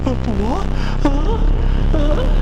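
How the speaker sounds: loud high panicked outcry; horrified shout. Acted. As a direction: recoil in shrieking horror. Horrified at mating frogs.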